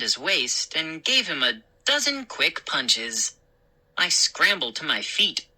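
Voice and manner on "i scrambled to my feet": ordinary delivery, nothing marked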